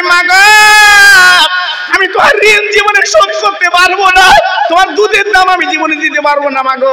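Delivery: high, loud sermon cry; loud high lamenting voice; climactic wail. Acted. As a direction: build to the peak of the sermon.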